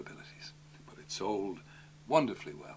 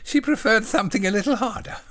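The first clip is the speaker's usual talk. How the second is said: high, simple-minded little voice; eager, high-pitched polite question; childlike John; 12 semitones higher, 12 dB louder than usual. Acted. Voicing John in the story.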